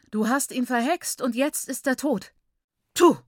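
furious accusation. Acted. Mother accuses the aunt of bewitching her son, high and furious. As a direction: hurl the blame at her.

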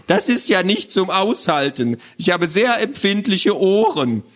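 high, fussy little creature; squawky complaint. Acted. Creature with sensitive ears complains to children, squawky. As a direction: squawk about it, put upon. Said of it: "eine quäkende Stimme".

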